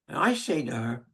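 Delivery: normal talking voice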